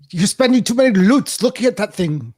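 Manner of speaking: high-pitched mock British scolding; mock British accent. Caller riffs on British slang, high mock British accent. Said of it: "In, in British, it's loots".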